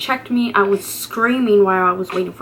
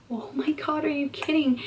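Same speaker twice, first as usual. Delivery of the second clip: higher and much softer, breathless disbelief; stunned disbelief; 6 semitones higher, 8 dB quieter than usual. Retold or imitated. To the doctor, hearing she's only five centimeters, stunned and hushed. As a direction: gasp it out as if you can't believe it.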